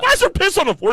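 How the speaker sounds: mock indignant. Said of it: "I was like".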